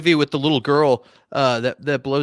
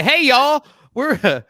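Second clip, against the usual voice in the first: hammed-up Texas cowboy greeting; Texan accent; 9 semitones higher, slower than usual. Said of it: "I'm from Texas".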